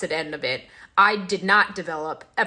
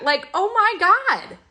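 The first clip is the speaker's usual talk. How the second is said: exasperated; 12 semitones higher, 4 dB louder, slower than usual. Real, not acted. Exasperated at bullying parent.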